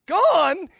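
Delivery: loud, high incredulous yell; upset disbelief. Acted. Doctor told that Bob is gone, incredulous shout. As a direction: throw the word back in outraged disbelief. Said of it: "he's all upset".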